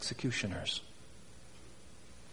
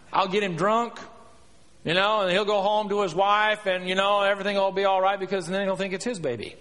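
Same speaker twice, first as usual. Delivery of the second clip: higher, rattled-off plan; scheming king; 6 semitones higher, 5 dB louder than usual. Acted. David plotting to cover up with Uriah, scheming. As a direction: talk yourself into a cover-up, breezily.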